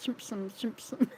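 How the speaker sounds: laughing through the last chimp slim; giggling as the twister falls apart. Real, not acted. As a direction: break into laughter mid twister. Fails tongue twister, laughs.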